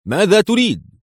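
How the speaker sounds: high, forceful demand; demanding question. A narrator's reading. General challenges the intruder, demanding. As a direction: pitch it up, demand an answer.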